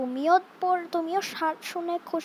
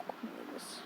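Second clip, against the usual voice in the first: hushed whisper; 19 dB quieter than usual. Real, not acted. Gamer after putting the baby to sleep, soft whisper. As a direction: breathe it out quietly. Baby fell asleep, hushing.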